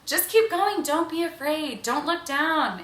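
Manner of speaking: put-on high, loud cheering on a nervous child on a bike; encouraging a kid. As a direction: pump the kid up, then ease off. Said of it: "we're telling this kid".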